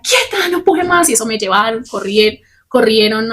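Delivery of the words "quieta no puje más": high, slow urgent command; nurse calling out. Retold or imitated. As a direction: stop her pushing, call it out to her. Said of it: "pasó una enfermera". (enfermera ordenando con urgencia, aguda y lenta)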